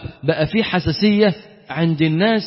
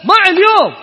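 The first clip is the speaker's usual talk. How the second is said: mock exasperated cry; 11 semitones higher, 9 dB louder than usual. Boss lamenting the lost workday.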